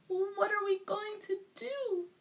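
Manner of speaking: high distraught cry; a mother at her wits' end. A narrator's reading. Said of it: "cried Missus Cream".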